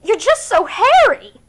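high, loud and slow with a rising Capitol lilt; silly Capitol accent. A narrator's reading. Vienna teases Katniss about her hair, silly Capitol accent. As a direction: sing it out like a frivolous Capitol stylist. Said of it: "she pipes in her silly capital accent".

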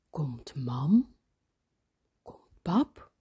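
half-whispered, suspenseful questions; hushed wondering. A narrator's reading. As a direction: ask it under the breath, listening out. Boy listens, afraid of waking parents.

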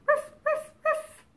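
high little-dog yaps; dog bark. Pretend. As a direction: bark in short high bursts.

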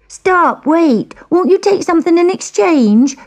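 put-on high, pleading girl; begging girl. A narrator's reading. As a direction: beg her desperately. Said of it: "begged the girl".

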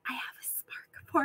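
breathy whisper of a secret crush; hushed, unvoiced admission; whispered confession. Confessing the cat to Aaron.

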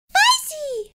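very high, squeaky delighted cry; little Emma. A narrator's reading. Emma naming the hulking monster, high child squeak. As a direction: squeak it like a tot. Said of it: "sharp-clawed monster and said".

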